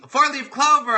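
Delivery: light up at the word; delighted. Real, not acted. Host reads a viewer's lucky word, delighted.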